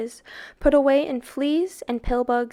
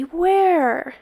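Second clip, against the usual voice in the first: urgent where; 6 semitones higher, 5 dB louder than usual. A narrator's reading. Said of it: "thought Tom".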